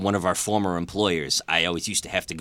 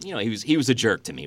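ordinary delivery

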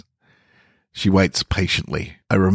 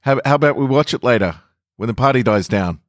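nervous call; 6 semitones higher, faster than usual. A narrator's reading. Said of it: "I call after her".

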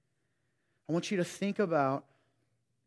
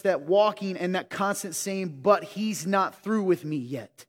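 louder, building sermon delivery; full-voiced preacher driving the point home; fired-up preaching; 3 semitones higher, 5 dB louder than usual. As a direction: preach it out with rising force. Rising preaching to the congregation.

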